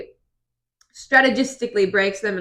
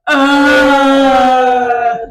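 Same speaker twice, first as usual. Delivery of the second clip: loud, high groan of disgust; 4 semitones higher, 10 dB louder than usual. Retold or imitated. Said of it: "me and Alana both go".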